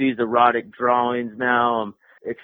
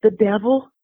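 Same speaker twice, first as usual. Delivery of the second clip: higher-pitched quoted questioner; retold questioner; 9 semitones higher than usual. Someone asking a child about a drawing, retold in a higher voice. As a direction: ask it in a raised, imitating voice.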